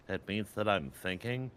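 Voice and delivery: ordinary talk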